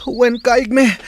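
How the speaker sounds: loud, high answer called across the training ground; eager student shouting back; shouted reply. Acted. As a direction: bark out a respectful reply to the master. Student answering master during training.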